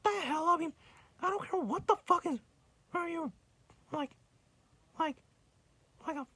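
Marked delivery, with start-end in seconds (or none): none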